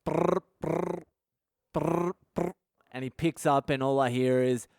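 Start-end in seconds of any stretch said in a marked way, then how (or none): 0.0-2.5 trilled phone ring, repeated in pairs; imitated phone ringing. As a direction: roll out a ringing-phone noise. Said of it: "So the phone goes".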